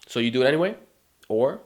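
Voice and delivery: ordinary delivery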